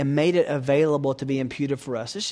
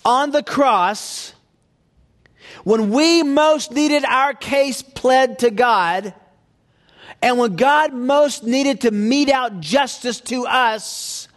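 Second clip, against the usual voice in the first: high, loud, slow preaching with long held words; slow, soaring pulpit climax; preaching at full force; 9 semitones higher, 7 dB louder, much slower than usual. Acted. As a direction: proclaim it from the pulpit, slow and ringing. Climax of the sermon on the cross.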